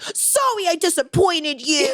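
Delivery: mocking whine. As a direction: imitate a friend's teasing, overdone apology. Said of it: "Mocking me".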